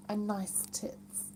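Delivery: unvoiced whisper, hushed and cheeky. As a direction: whisper it so nobody hears. Reading a cheeky comment.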